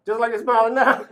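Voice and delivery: chuckling through the words; talking through real laughter; laughing. Real, not acted. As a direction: let the laugh spill into the line. Recalling sister's smiling visit.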